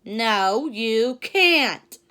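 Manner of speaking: loud, harsh monkey no; mean refusal. Acted. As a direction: shut him out bluntly. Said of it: "said the".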